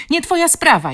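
high, loud retort; defiant shout. A narrator's reading. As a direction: throw it back at him loudly. Girl shouts back at bully.

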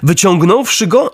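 ordinary delivery

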